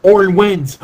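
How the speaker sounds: loud, high burst of excitement; excited. Real, not acted. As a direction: call out the winner like big news. Announcing a surprise result.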